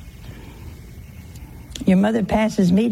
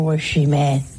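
normal talking voice

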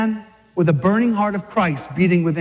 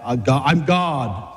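low, slow, assured declaration; man swagger; 7 semitones lower, slower than usual. Acted. Jesus declaring who he is, low swagger. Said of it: "How else do you say that?".

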